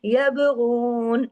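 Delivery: यबगून slow, ग़ैन thick and heavy from the throat; tajweed recitation. Shown as a demonstration. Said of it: "गैन को मोटा करना है". (यबगून धीमे, मोटे ग़ैन के साथ)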